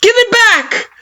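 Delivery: very high, loud demand, falling on it; defiant scream. Acted. You demanding the menu from Kara, defiant scream. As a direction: scream it at her. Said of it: "you scream, defiant".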